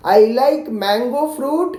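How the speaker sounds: slow, careful model sentence; spelled-out teacher answer. Shown as a demonstration. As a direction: say it slowly so the child can copy. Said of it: "तो मैं कैसे बोलूँगा".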